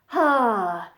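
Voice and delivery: airy annoyed sigh; exasperated sigh. Shown as a demonstration. Showing an exasperated sigh to learners, airy. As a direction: sigh like you're fed up. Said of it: "sound a bit more exasperated".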